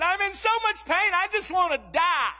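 high-pitched imitation of a suffering child; melodramatic, high mock wail; mock bawling. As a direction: ham up a daughter's agony. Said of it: "she cried and she bawled".

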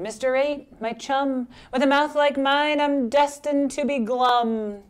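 high, plaintive, drawn out at the end; doleful pout-pout fish. Acted. Fish tells octopus he's destined for gloom, plaintive. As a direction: lament up high, stretching the last words.

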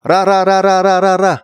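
high, loud rapid chant of ra; excited ra ra ra. Shown as a demonstration. Hurrying everyone out, an excited high loud chant. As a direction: urge everyone to move, chanting fast and loud. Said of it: "Repeat up to the point where everyone panics".

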